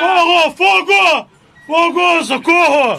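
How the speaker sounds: shouting for help. Retold or imitated. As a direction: yell it like you're drowning. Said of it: "ele começou a gritar". (gritando por socorro)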